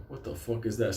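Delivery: hushed, wary inner voice; thinking to himself. Retold or imitated. Man hearing an odd noise in the woods, hushed thought. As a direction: say it softly to yourself. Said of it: "I'm thinking to myself".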